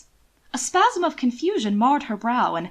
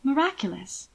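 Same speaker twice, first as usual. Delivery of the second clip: mild irony; 4 semitones higher than usual. A narrator's reading. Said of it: "ever so mild".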